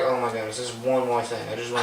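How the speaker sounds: low, muted mock dread; deep, soft retold exasperation; weary grumble. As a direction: grumble it under your breath, low. Weary retold toothbrushing complaint.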